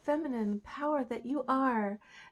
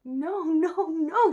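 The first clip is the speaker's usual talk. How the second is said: loud, high princess protest; acted indignant princess; outraged refusal; 7 semitones higher, 7 dB louder, much slower than usual. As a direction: refuse loudly, voice pitched up. Princess refuses frog's demand.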